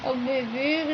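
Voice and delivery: ordinary talk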